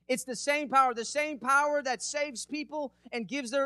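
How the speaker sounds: emphatic, driving sermon delivery; preaching. Acted. Preacher urging the church to praise a risen king, emphatic preaching. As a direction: preach it with conviction, building to praise.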